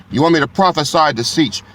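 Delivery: ordinary delivery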